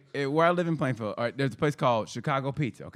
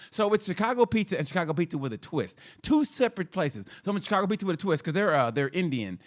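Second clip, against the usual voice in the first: ordinary talk, nothing marked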